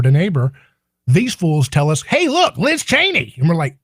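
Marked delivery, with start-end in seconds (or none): 2.0-3.3 mock media pitch. Mocking media distraction.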